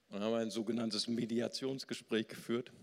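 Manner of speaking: normal talking voice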